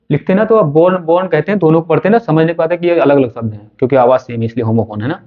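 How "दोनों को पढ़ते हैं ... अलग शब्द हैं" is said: ordinary talk, nothing marked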